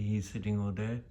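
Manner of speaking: normal talking voice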